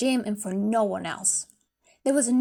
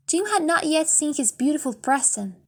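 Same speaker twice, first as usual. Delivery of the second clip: ordinary delivery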